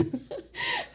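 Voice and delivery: short high laugh; a quick amused laugh; brief bright laugh at herself. Real, not acted. Laughs at teacher's remark.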